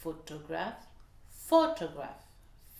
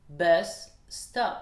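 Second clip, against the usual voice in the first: BUS and STOP each hit firmly and louder; both words stressed; 6 dB louder than usual. Shown as a demonstration. Teacher isolates the accented words, BUS and STOP both stressed. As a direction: punch BUS, then STOP. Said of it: "et bus et stop".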